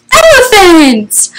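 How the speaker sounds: high, drawn-out delighted cry; slow, high-pitched mock amazement; excited reveal. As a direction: announce it as a big surprise for small children. Gasping surprise reveal to children.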